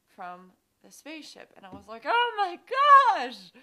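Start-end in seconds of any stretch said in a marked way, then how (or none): 2.0-3.5 high loud startled cry; shocked gasp. Retold or imitated. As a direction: blurt it out in shock. Realizing in dream.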